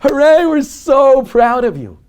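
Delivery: loud, high, drawn-out hooray; big, slow, high-pitched shout of praise; proud family cheer. Acted. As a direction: cheer like a whole family celebrating a child. Family cheering a small child.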